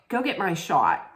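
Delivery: ordinary delivery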